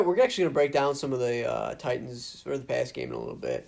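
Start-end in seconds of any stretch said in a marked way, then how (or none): none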